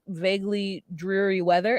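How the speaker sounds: normal talking voice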